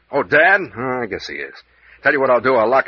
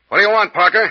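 calling out; 10 semitones higher, 6 dB louder than usual. Acted. Calls to Parker behind door.

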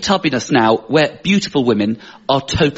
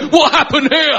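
mock exclamation; 11 semitones higher, 4 dB louder than usual. Friends grab his belly, exclaim.

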